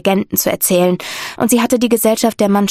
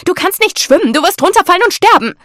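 high, loud horrified cry; horrified shout; 10 semitones higher, 5 dB louder than usual. A narrator's reading. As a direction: yell up in panic at the climbing daughter. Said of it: "rief Lady Bayford entsetzt".

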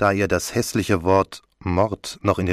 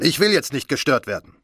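higher-pitched order; commanding tone; 7 semitones higher than usual. A narrator's reading. Commissioner does not want to be disturbed, commanding. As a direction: order the intruder off, mildly commanding. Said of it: "sagte er im Befehlston".

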